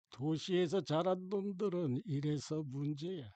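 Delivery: soft, slow, scornful master; sneering. A narrator's reading. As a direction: scoff quietly at an ignorant pupil, taking your time. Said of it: "평소처럼 비웃는 듯한 말투로".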